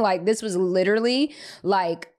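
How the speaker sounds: plain reading voice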